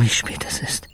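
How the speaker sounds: trailing into a breathy whisper. Acted. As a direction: let the voice drop away to nothing. Desperate inner voice at night.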